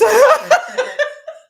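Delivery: sudden loud, high-pitched burst of laughter; real cackle. Real, not acted. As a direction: crack up mid-sentence. Overcome by viewer's joke.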